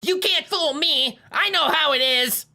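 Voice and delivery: put-on petulant child villain complaint; whiny kid villain. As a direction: whine like a spoiled kid who lost. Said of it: "a really whiny voice".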